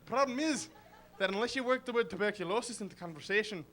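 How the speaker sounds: lilting, comic Irish accent. Shown as a demonstration. Comic showing what the trigger word does, lilting Irish accent. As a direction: rattle along in a put-on Irish brogue. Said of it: "suddenly you're automatically in an Irish accent".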